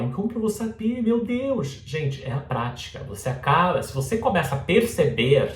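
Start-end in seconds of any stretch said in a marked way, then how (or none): 0.0-1.8 high, quick mock panic; worried student. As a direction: voice a fretting learner, pitched way up. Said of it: "E aí você me fala". (aluno aflito, voz aguda de brincadeira)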